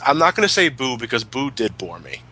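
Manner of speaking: usual speaking voice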